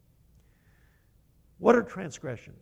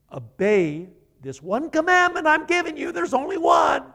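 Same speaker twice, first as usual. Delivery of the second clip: much higher and louder, a booming divine command; voice of God commanding; 12 semitones higher, 8 dB louder than usual. Pretend. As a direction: proclaim it like God laying down the one law. Preacher voices God's command.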